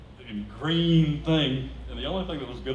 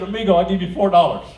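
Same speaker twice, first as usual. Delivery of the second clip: louder mock bargaining; haggling; 6 semitones higher, 9 dB louder than usual. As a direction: drive a hard bargain. Haggling with vendor.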